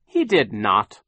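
loud, emphatic denial; shouted protest. A narrator's reading. Violet contradicts Sham's rescue story, shouting. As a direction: shout down a lie. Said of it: "Violet shouted".

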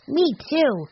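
high-pitched, keen agreement; little kid joining in; eager little boy. A narrator's reading. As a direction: chime in high like a small boy. Benny agrees eagerly in story.

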